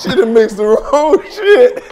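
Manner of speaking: slow, laughing it out. Real, not acted. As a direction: drag it out while cracking up. Laughing at drugged woman.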